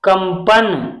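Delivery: normal talking voice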